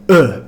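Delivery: "uh", the New Zealand short I as a central vowel. Shown as a demonstration. Teacher demonstrating the New Zealand vowel, short I as uh. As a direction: say the letter I's sound as a short "uh". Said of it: "like to pronounce it like".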